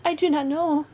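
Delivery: high, loud plea of innocence; frightened cry. A narrator's reading. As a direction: cry it out like a terrified wife. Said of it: "cried the poor woman, paler than death".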